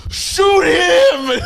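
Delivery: very high mock-panicked shout. Storyteller imitates the clerk urging him to fire, high frantic shout. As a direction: imitate a frantic bystander shrieking an order.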